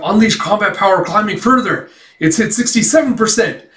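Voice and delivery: high-pitched, excited system announcement; power-level announcement. Retold or imitated. Announcing the suit's climbing combat power, high and excited. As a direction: imitate an alert calling out rising stats.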